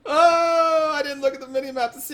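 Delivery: high despairing oh; anguished cry. Real, not acted. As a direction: cry out in frustration. Still reeling from mistake.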